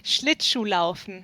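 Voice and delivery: "Schlittschuhlaufen" said slowly and clearly in native German; German word. Shown as a demonstration. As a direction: repeat "Schlittschuhlaufen" slowly for a learner. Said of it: "Did you say that again?".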